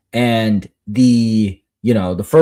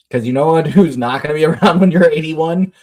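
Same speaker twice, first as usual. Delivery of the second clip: ordinary talk, nothing marked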